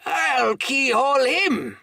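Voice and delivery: high, loud blustering excitement; quite excited. Acted. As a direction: rant and stump about. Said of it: "now quite excited".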